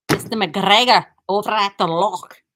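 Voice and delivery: loud, hammed-up Scottish burr; terrible Scottish accent. Shown as a demonstration. Shop host mimicking Mister McGregor, terrible Scottish accent. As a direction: put on a bad Scottish accent. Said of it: "my terrible Scottish accent".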